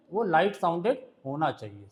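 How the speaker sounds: ordinary talk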